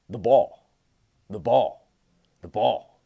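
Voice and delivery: each ball punched loud, fading a little each time; emphatic repetition. Shown as a demonstration. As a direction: drill the ball into their heads. Coach hammers home the key point.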